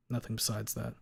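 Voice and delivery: usual speaking voice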